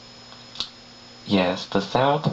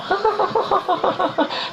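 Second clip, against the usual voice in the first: high squealing laugh. Acted. Laughs at own joke.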